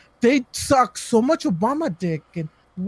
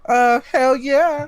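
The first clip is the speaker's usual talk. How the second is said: sarcastic; 6 semitones higher, 4 dB louder, slower than usual. Acted. Sarcastic reply about the mall.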